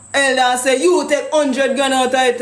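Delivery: ordinary talk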